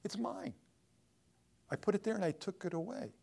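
lightly voiced God reproving Jonah; God's voice. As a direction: speak as God setting him straight. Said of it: "He says".

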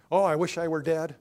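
loud self-pitying moan; pity-party wail. Acted. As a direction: wail it out, feeling sorry for yourself. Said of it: "you're in a pity party".

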